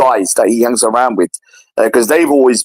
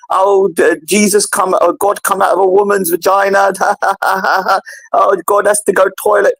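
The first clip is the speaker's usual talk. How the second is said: higher, scornful mock voice with a laugh; mocking ridicule; 6 semitones higher than usual. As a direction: sneer at the idea of God being born. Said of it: "You're mocking".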